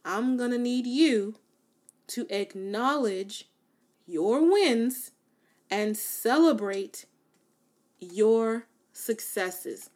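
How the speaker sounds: "acknowledge", "celebrate", "successes" drawn out slow; loud emphatic repeat. Shown as a demonstration. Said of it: "say it one more time".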